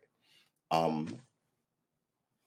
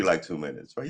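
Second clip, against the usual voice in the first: ordinary delivery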